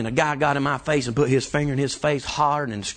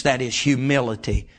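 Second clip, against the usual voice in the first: ordinary delivery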